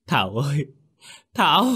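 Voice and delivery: high, desperate name-calling; gọi như kẻ tâm thần. A narrator's reading. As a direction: cry out the name frantically. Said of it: "vừa chạy vừa gọi tên Thảo như một kẻ tâm thần". (gọi tên thất thanh, cuống cuồng)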